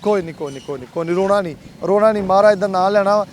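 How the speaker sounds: calm him like a friend; hushed soothing. Real, not acted. Comforting a crying old man.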